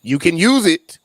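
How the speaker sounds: loud, high, emphatic. Real, not acted. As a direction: push it up with conviction. Joking about belonging in restroom.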